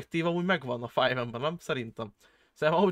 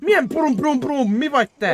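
high, loud exasperated challenge; incredulous outburst; 10 semitones higher, 8 dB louder than usual. Real, not acted. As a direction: snap back loudly in disbelief. Baffled at friend imitating gunfire.

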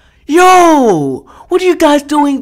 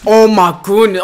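ordinary talk

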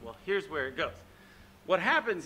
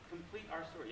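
soft repeat; 2 semitones lower, 14 dB quieter, faster than usual. Shown as a demonstration.

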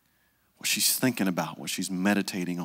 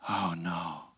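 usual speaking voice

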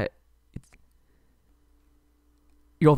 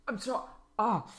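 pained gasp; 10 semitones higher, 9 dB quieter than usual. Acted. Struck again.

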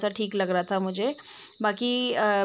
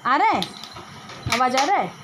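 raised voice; 3 semitones higher, faster than usual. Real, not acted. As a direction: call out clearly to someone hard of hearing. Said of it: "ज़ोर ज़ोर से चिल्ला चिल्ला के बोलना पड़ता है".

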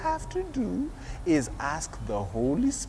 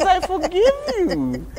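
forgiving mommy; 12 semitones higher, 9 dB louder than usual. Retold or imitated. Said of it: "And Mommy says".

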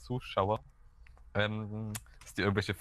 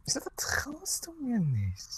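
slow high unvoiced whisper; creepy whisper; 13 semitones higher, much slower than usual.

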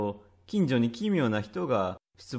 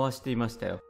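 usual speaking voice

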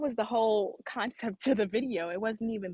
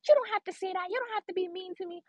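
higher-pitched imagined protest; mock pleading; 7 semitones higher, faster than usual. Woman answering hateful commenters she never replied to, mock pleading. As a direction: plead back in a raised, put-on voice.

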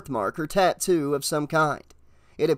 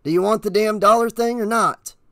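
fed-up, louder and higher; impatient stranger's raised voice; grating, impatient; 6 semitones higher, 5 dB louder than usual. A narrator's reading. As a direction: press for an answer, patience gone. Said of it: "his voice grating, as if he were tired of this game".

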